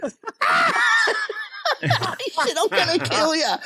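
high-pitched, laughing through the words; cracking up. Real, not acted. Mate recalling the threat and blue face, cracking up. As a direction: barely get it out through real laughter.

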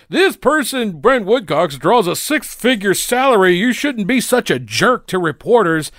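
high, whiny mock reporter voice. Reporter scolding the lawyer, high whiny mockery. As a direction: sneer it as a prissy scold.

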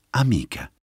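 plain reading voice

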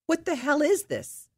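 retold outrage at the captain; indignant outburst. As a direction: fling the question at him in disbelief. Confronting captain over removal.

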